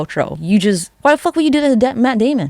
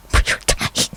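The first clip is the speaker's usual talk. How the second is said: hushed aside. Retold or imitated. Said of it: "so he's like".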